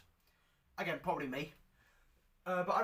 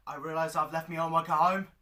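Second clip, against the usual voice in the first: normal talking voice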